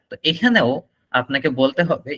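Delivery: normal talking voice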